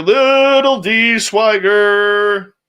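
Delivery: loud, high, stretched-out hail; drawn-out greeting. Real, not acted. Host greeting a viewer joining the stream, drawn out loudly.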